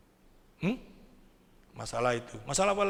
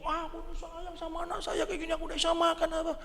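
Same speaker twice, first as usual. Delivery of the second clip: high, soft lamenting voice; mock complaining; 11 semitones higher, 5 dB quieter than usual. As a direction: whine it high and small, hammed-up. Mock announcing of own troubles. (keluhan dibuat-buat, suara tinggi pelan)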